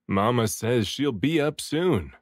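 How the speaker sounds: usual speaking voice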